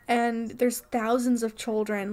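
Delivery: ordinary talk, nothing marked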